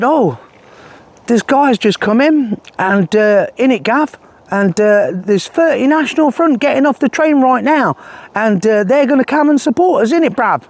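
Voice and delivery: high-pitched mock London geezer; imitation Cockney, pitched well up; Tommy's fake London accent. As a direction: send up a put-on London accent. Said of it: "I'm using this accent because Tommy's fake accent".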